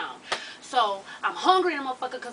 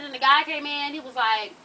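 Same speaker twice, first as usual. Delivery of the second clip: ordinary talk, nothing marked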